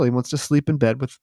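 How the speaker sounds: ordinary talk, nothing marked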